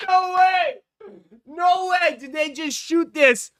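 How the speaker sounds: loud, high, laughing disbelief; can't believe what you're seeing; excited disbelief. Real, not acted. Shocked by the phone-shot trailer.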